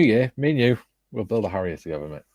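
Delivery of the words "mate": normal talking voice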